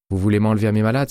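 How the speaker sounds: rapid, loud, furious reproach; fast angry outburst. A narrator's reading. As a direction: snap it out at him, indignant. Angry nurse scolds doctor.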